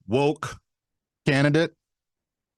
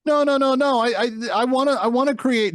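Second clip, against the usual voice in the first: higher, flustered denial voice; mocking Kamala Harris; 8 semitones higher than usual. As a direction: stammer it like a cornered politician.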